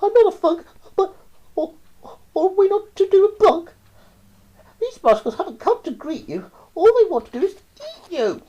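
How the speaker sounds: put-on high, loud child's voice; a scared kid pleading; frightened child. A narrator's reading. Child warns the toad about the rascals, high.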